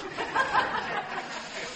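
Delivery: high, breathy burst of laughter; real high-pitched chuckle. Real, not acted. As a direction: laugh out at the punchline. Preacher laughs at own story.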